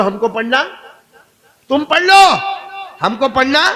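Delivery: high, loud call with rising ends; mock retort. Preacher voicing the enemy refusing to pray, loud mock retort. Acts enemies' retort, prompting crowd.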